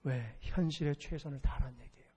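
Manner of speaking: normal talking voice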